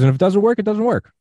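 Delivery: high-pitched, casual retold reassurance; lifted, high quoted voice; animated quoting. Retelling guest's pitch for an experiment.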